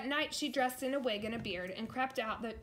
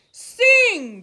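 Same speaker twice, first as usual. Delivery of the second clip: very loud, high bellow; bellowed command; 12 semitones higher, 22 dB louder than usual. A narrator's reading. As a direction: bellow the order like a giant. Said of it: "bellowed the giant".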